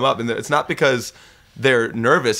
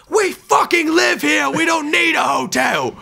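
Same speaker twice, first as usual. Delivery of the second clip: put-on much higher, louder British-accent shout, slow and furious; angry British accent; 4 dB louder than usual. Swearing at an airline official in a British accent, furious shout. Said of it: "I don't know why I had a British accent".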